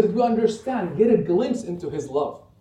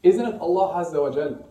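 normal talking voice

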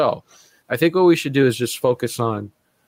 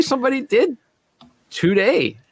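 excited; 12 semitones higher than usual. Real, not acted. Surprising news, jumps up in pitch.

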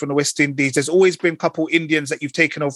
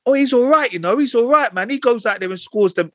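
lightly put-on English accent, higher pitch; a touch of mock typical English, pitched up; 7 semitones higher than usual. Mimicking cricket fans excusing a star player, typical English accent. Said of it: "doing that typical English accent".

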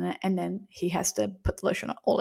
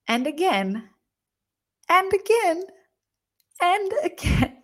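each "again" climbing higher after a pause; escalating repetition; 9 semitones higher, slower than usual. Retold or imitated. Storyteller counting each repeat of the act, escalating repetition. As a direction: build "again" up to a high, disbelieving peak.

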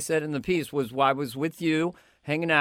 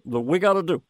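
Obama impression; 4 semitones higher than usual. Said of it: "Obama'd get up there".

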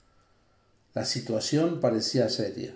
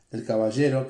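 ordinary delivery

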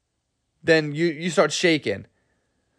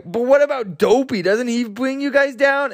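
druggie dwarf; 8 semitones higher than usual. Retold or imitated. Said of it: "Druggy's like".

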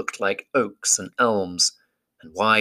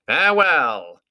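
lofty higher eagle call; eagle farewell; 6 semitones higher, 4 dB louder, slower than usual. Acted. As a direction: ring it out like a great bird.

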